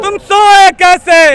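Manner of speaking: slow, high, loud chanted call; slogan shout. Real, not acted. Activist leads protesters against the government, shouting. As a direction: shout the line for the crowd to answer. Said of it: "पूरी आवाज़ से बोलेंगे".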